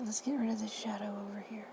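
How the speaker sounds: usual speaking voice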